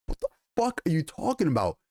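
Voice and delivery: mock what-the-hell shock at the queen; hammed-up stunned reaction; incredulous outburst. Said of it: "everyone's like".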